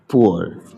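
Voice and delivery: पुअर said as "poo-ar", two clear syllables; teacher models पुअर slowly as "poo-ar"; pronounce poor. Shown as a demonstration. Teacher models the word for students. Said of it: "पुअर कहिएगा".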